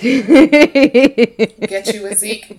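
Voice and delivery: mock announcement. Playfully announcing the hashtag.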